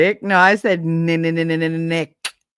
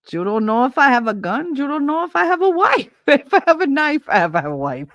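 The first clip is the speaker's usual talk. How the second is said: laughing through quote; 7 semitones higher than usual. Retold or imitated. Quoting the typo line.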